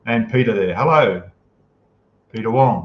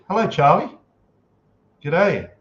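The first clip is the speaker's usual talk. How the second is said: cheery greeting; 7 semitones higher, 4 dB louder than usual. Real, not acted. Greeting a viewer in chat.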